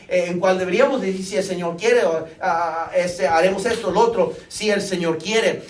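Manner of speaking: loud, high, urgent preaching from the pulpit; fervent sermon climax; full-force preaching. Acted. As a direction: preach it out to the congregation, pressing hard.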